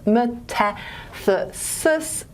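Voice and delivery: "metathesis" drawn out very slowly, each syllable separate; very slow, spelled-out "me-ta-the-sis". Shown as a demonstration. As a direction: sound out "metathesis" piece by piece for the class. Term broken down syllable by syllable.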